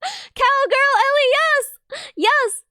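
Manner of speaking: high, loud delighted cry; excited squeal. Real, not acted. Streamer squealing at fan art of herself.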